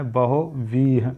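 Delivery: बहुव्रीहि slowly, ba-hu-vree-hi; drawn out बहुव्रीहि for students; slow correct form. Shown as a demonstration. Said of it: "व्रीहि नहीं होता है". (बहुव्रीहि धीमा उच्चारण)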